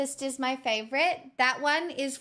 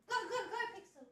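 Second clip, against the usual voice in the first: high, quick cheering; excited cheer; 11 semitones higher, 9 dB quieter, faster than usual. Real, not acted. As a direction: egg the dog on, high. Cheering on her dog.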